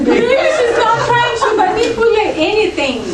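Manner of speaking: high, loud, laughing through the words. Real, not acted. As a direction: giggle it out, amused at the idea. Continues laughing over sweet wife.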